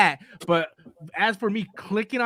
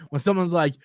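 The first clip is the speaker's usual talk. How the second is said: normal talking voice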